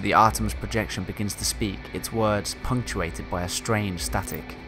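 calm reading of a log; story narration. Acted. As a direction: read it like a captain's journal. Reads game log aloud.

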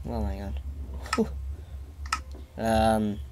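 normal talking voice

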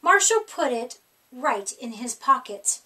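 ordinary delivery

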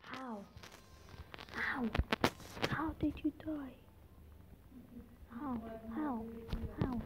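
puzzled whisper; whispered question. Real, not acted. Vlogger asking a dead player how they died, whispered. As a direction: breathe the question under your breath. Wonders at in-game death.

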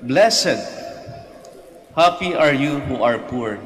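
loud, ringing proclamation of the Gospel; preaching at full force. Acted. Priest proclaiming the Beatitudes to the congregation, loud and ringing. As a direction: proclaim it to the whole church.

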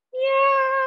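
high-pitched imitation meow; cat meow. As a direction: squeal out a long meow. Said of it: "Now you're a cat".

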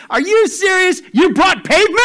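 very high, loud mock disbelief; hammed-up incredulous yelling; incredulous shout. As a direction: shriek the punchline in disbelief. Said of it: "all of a sudden he starts laughing".

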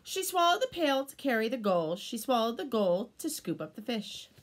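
ordinary delivery, nothing marked

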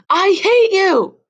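put-on girl screams her hatred at her father; screamed outburst. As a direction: scream it at him with everything. Daughter erupts at father.

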